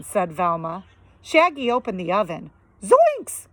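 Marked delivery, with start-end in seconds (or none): 2.8-3.5 high, loud yelp of shock. Acted. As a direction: jump at the empty oven. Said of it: "cried Shaggy".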